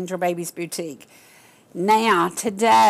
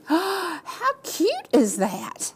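high, slow squealing delight; breathy gasp of delight; gushing gasp; 10 semitones higher, much slower than usual. Real, not acted. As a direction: coo over it like it's the cutest thing ever. Gasping over baby clothes.

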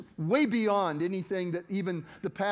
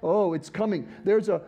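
ordinary delivery

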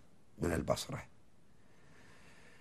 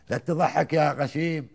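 louder and slower, driving the line home; emphatic recited verse; 7 semitones higher, 6 dB louder, slower than usual. Acted. Reciting the poem's jab at the fool, loud and slow. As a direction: hit the punchline hard.

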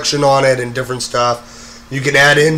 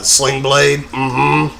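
Sling Blade voice; much slower than usual. Retold or imitated. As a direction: drop into a Sling Blade grunt. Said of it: "like, uh".

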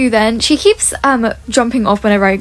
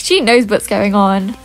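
ordinary talk

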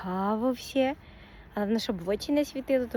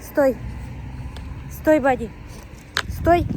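loud sharp stop command; loud firm order for the dog to stop; 3 semitones higher, 4 dB louder, slower than usual. Real, not acted. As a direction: make the dog halt at once. Stopping the dog on walk.

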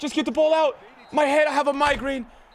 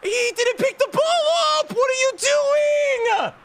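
berserk rage; 9 semitones higher, slower than usual. Real, not acted. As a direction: yell at the screen, losing it. Keeper blunder, raging disbelief.

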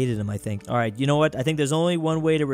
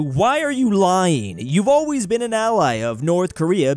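slow, loud accusation; 4 semitones higher, 5 dB louder than usual. Acted. Accusing the Chinese leader.

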